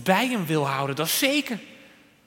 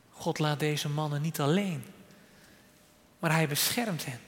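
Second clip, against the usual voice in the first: normal talking voice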